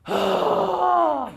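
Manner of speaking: loud, high exasperated huh; teen huff. Shown as a demonstration. Teacher imitating a teenager calming themselves down. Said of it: "by going".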